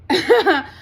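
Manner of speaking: short burst of real laughter; a quick embarrassed laugh; sudden laugh. Real, not acted. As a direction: laugh at yourself, caught out. Laughs at own admission of lying.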